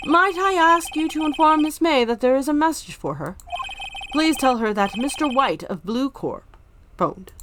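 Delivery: high, eager hotel bellboy; bow and greet like a proper bellhop; chirpy old-fashioned bellhop. Acted. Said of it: "I am the bellboy".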